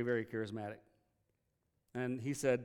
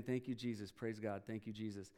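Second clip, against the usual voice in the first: softer, fading murmur of thanks; dying mumble; 5 semitones lower, 9 dB quieter than usual. Retold or imitated. A dying man softly thanking Jesus from his hospital bed. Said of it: "he kept saying".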